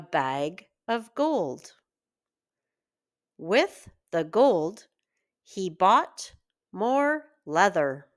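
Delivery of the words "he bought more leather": ordinary delivery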